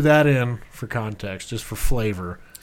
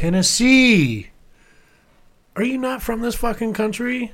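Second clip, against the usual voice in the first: incredulous; 10 semitones higher, 3 dB louder than usual. Real, not acted. Friend doesn't know Knoxville's state.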